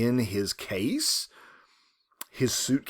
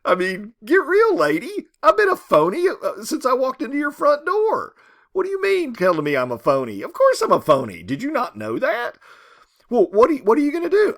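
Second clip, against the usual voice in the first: higher, louder mocking salesman; sneering con man; 11 semitones higher, 6 dB louder than usual. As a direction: taunt her with high, loud scorn. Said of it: "And he says".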